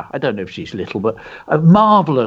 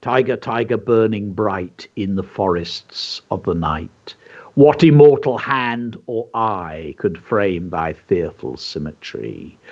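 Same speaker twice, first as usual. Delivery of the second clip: poem recitation; 5 semitones lower than usual. Shown as a demonstration. Presenter reciting Blake's Tiger poem to children. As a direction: recite it low and unhurried. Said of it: "William Blake poem".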